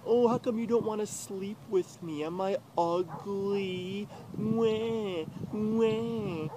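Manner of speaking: high, whiny mock voice ending in fake "wah, wah" crying; mocking whiny girlfriend. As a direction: mimic her as a sulky crybaby. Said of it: "And she's like".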